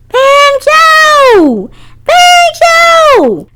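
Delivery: high, loud calling of a name. Acted. Girl calling her cat, loud and high. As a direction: call for the lost cat across the house. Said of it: "Sammy calling his name".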